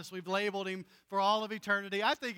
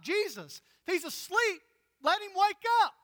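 high, loud protest; exasperated disciples; 11 semitones higher, 6 dB louder than usual. Acted. Disciples protesting to Jesus, high and loud. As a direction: throw it back at Jesus, incredulous.

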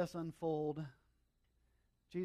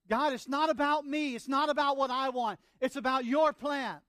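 very loud, high-pitched plea to God; impassioned preaching; 10 semitones higher, 12 dB louder than usual. Acted. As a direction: preach the prayer at full force. Preacher voices Jesus praying in agony.

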